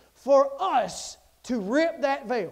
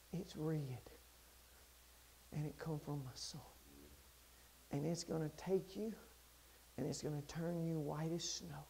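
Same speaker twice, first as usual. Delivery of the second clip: very low, very soft, solemn; hushed promise; 11 semitones lower, 23 dB quieter than usual. Acted. God promising cleansing by his son, hushed and solemn. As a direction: let it fall almost to nothing, reverently.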